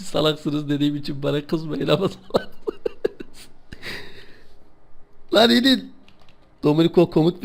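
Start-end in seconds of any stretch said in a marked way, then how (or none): 0.0-3.5 reading chat laughing. Retold or imitated. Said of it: "Chatten biri yazmış ki".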